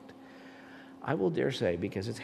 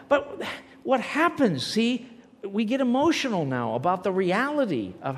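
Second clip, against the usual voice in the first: louder, higher and fervent; worked up; 8 semitones higher, 6 dB louder than usual. Real, not acted. Telling the class about God's work, worked up and fervent. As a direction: preach it with excited conviction. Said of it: "I'll try to be a little more dignified".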